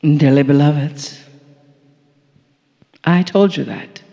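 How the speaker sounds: a channeled guide's low, unhurried closing benediction; solemn blessing. Acted.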